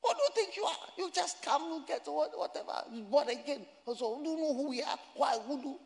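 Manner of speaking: very high, spluttering mock indignation; imitated angry rant. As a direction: mimic an outraged man ranting in a squeaky-high pitch. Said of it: "The man was angry".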